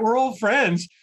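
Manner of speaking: ordinary talk, nothing marked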